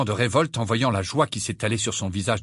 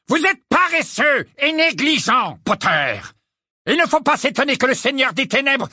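furious Snape; 12 semitones higher, 4 dB louder than usual. A narrator's reading. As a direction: lash out at a careless pupil. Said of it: "Rogue était encore plus furieux".

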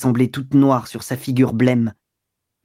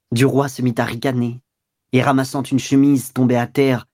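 ordinary delivery, nothing marked

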